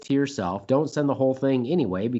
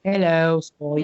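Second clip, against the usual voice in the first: higher, louder mock Cockney; British accent; 7 semitones higher, 5 dB louder, much slower than usual. Shown as a demonstration. Host doing a Skype sound check, British accent. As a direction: put on a rough British accent. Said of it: "I do it in a British accent".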